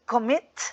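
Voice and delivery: "Commit" with the stress on MIT; stress on second syllable. Shown as a demonstration. Teacher giving another two-syllable verb example, "Commit" stressed on its second syllable. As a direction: say "Commit" as com-MIT, punching the end. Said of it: "la fuerza la hacemos en la segunda".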